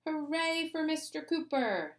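high, excited cheer; cheering shout. A narrator's reading. Michael cheering for Mister Cooper, high cheer. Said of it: "shouted Michael".

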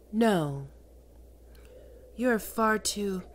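normal talking voice

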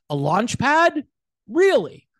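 high, loud disbelief; rising, sceptical challenge; incredulous. Real, not acted. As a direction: echo the phrase as a scornful question. Incredulous reaction to article's phrase.

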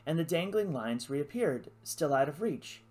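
usual speaking voice

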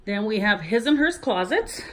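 ordinary talk, nothing marked

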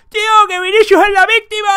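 high, loud whiny mock voice; mocking know-it-all. Imitating the group's know-it-all defending Vinicius, high and loud. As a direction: sneer at the smart-aleck commenter. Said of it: "y diga".

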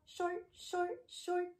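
high, soft, short words. Shown as a demonstration. Teacher showing what short means, high little voice. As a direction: keep each one little and high. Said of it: "tall and short".

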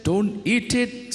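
high, slow stern voice of God; a touch of a commanding God; God's command. Acted. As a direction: forbid it gently but firmly. God forbids, voiced by preacher.

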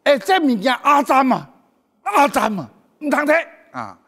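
a hint of an old granny warning the kid off; grandma's warning. Grandma warning a little child not to touch, mock scolding. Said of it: "阿嬷甲阮讲".

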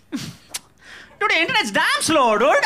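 high, laughing mooch voice; mock whiny guest. Guest complaining to the host about his Wi-Fi, high and laughing. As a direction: ham up a whiny guest who mocks the Wi-Fi. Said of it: "and be like".

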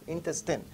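"Intestine" ending in a short, falling -tin; "Intestine", short i at the end, said quietly; correct pronunciation. Shown as a demonstration. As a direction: say "Intestine" with last syllable like "tin". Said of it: "That's wrong".

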